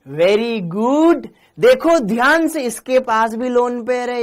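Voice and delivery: high, loud, slow exclamation; excited classroom call; excited teacher. Real, not acted. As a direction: burst out to grab the class. Teacher excitedly urging attention.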